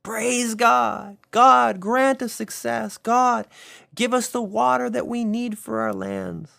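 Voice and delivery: high, loud festive cry of praise; crowd shouting praise. Acted. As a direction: call out to God over the crowd.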